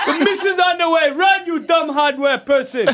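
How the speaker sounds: fast, high, cracking up mid-threat; can't hold the prank voice for laughing; laughing mock threat. Prank caller warning hardware of missiles, laughing through it. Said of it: "This is Muammar Gaddafi".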